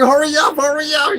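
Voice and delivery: high, loud retold urging; urgent shout. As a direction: act out frantic rushing. Retelling urging girlfriend.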